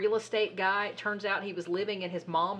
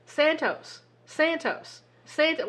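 higher-pitched mock calling-out of a name; imitated roll call; 7 semitones higher, slower than usual. Host imitating officials calling him to vote, mock calling out. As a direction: call the name repeatedly, half-projected.

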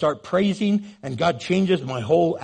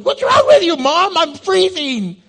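put-on very high, loud complaining baby; squeaky baby voice; 12 semitones higher, 7 dB louder than usual. Unborn baby complaining to mom about the icy glass. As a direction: squeal it like an unborn baby hit by ice water. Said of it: "the baby's going".

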